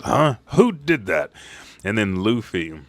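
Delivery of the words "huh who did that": startled villain. Retold or imitated. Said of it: "And Samura says".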